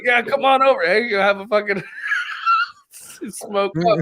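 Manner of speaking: high, mock welcoming; fake friendly invite. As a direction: lure him in sweetly. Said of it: "they're like".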